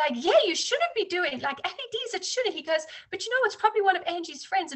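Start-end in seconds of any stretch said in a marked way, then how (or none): 0.2-2.5 imitated husband, much higher and louder; a knowing husband, pitched way up; husband's voice. Husband quoted at neighbors' dinner. 3.1-4.7 teasing husband. Retold or imitated. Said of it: "and bursts out laughing".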